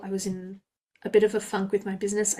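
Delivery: normal talking voice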